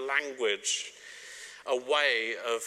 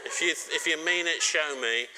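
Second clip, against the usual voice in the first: louder playful nagging, a bit higher; mock bossy wife; 5 semitones higher, 5 dB louder than usual. Wife teasingly ordering husband to take rubbish out, loud mock nag. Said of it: "She says".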